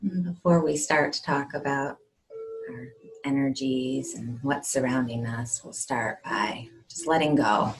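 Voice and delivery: hushed, unhurried guide into calm; guided meditation. Acted. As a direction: settle them gently, leaving space between phrases.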